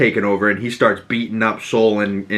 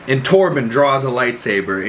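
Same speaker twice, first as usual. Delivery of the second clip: normal talking voice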